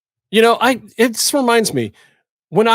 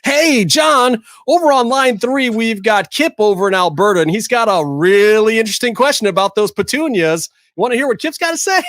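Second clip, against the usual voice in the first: high, peppy phone-in host patter; chirpy radio sidekick handing off a caller; imitating his old radio sidekick voice; 6 semitones higher than usual. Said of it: "I would do the".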